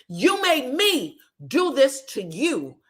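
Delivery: hammed-up finger-pointing; mock accusing. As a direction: draw out the accusation, amused. Retold blame, repeated after laughing.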